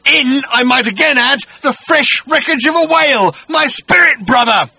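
high, loud monster's tirade; ranting Agrajag. Acted. Agrajag accusing Arthur over his deaths, high ranting shout. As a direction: shout it as an aggrieved creature.

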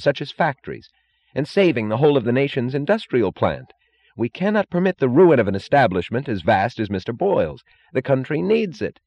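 high-pitched, insistent justification; earnest official. A narrator's reading.

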